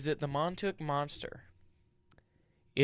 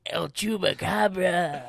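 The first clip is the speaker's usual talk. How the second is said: put-on slow, loud El Chupacabra in a Spanish accent; 7 semitones higher, 8 dB louder, slower than usual. Echoing the Spanish accent joke.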